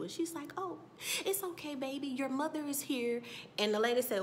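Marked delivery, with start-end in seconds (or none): 0.6-3.2 higher, gentle imitated motherly coo; soothing a baby. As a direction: reassure a baby in a lifted voice. Acting out Serena soothing baby.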